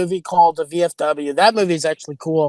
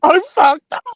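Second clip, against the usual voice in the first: high, slow petulant whine; pampered star scolding the staff; diva complaint; 8 semitones higher than usual. Pretend. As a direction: gripe like a spoiled star. Mocking diva voice.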